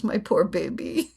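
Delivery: slow, cooing mother comfort; lightly voiced soothing mother; mock tender comfort. Mother comforting her crying child, slow tender coo. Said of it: "the mother also comes and says".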